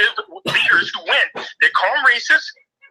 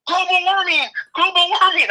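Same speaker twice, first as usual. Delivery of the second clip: much higher, sing-song chant; mock alarm; 8 semitones higher than usual. Host parodying climate alarmists, high sing-song mock chant. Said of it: "they came back and they said".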